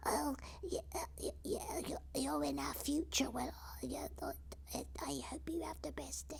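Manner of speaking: very high, soft, whispery voice; put-on frail voice. Character wishing a viewer the best day, high whispery voice. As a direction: speak high and faintly, as a quavery little character. Puts on a voice mid-reading.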